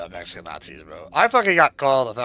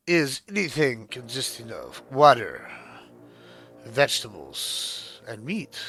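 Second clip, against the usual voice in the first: imitating Deej; 3 dB quieter, slower than usual. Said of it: "he's like".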